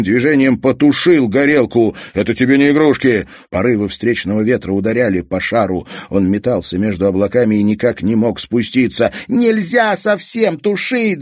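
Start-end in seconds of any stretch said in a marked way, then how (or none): 2.1-3.3 loud, fast rebuke; scolding. Acted. Dik scolding. 9.3-11.2 put-on high, slow alarmed boyish yell; shouting boy. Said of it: "закричал Казик".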